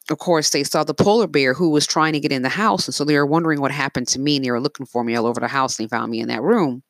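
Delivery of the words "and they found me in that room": plain reading voice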